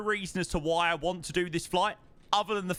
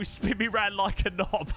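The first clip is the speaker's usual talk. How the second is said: higher, breaking into laughter; laughing; 6 semitones higher than usual. Real, not acted. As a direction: say it while cracking up for real. Cracks up at misheard lyric.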